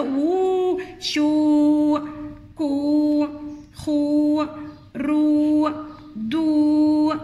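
وو، شو، كو stretched with a long rounded oo; long vowel sounds. Shown as a demonstration. Teacher showing children how to lengthen the oo sound. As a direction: draw each وو out, lips pushed forward. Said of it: "يلا مد بقك كده".

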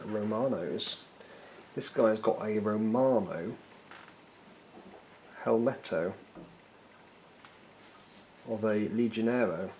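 tacking Italian endings on English words; mock Italian words. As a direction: fake Italian endings, drawn out. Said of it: "All that is legitimate Italian words".

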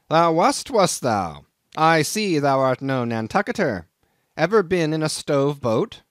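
crusty Nantucket captain sizing up a greenhorn; gruff old sea captain. Acted. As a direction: bristle at the stranger like a crusty old skipper. Old captain questions Ishmael.

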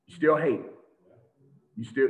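ordinary delivery

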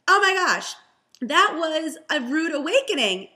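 ordinary talk, nothing marked

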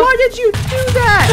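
panicked shout. Real, not acted. Player yelling at friend who clicked it, panicked shout. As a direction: yell at your friend in panic.

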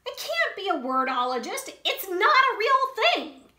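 fed up, pleading at the end; exasperated. Acted. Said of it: "Dirt was exasperated".

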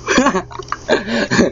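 high-pitched burst of laughter; giggling. Real, not acted. Player feeling better after losing, high giggle. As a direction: laugh off the lost round.

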